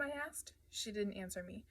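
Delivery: plain reading voice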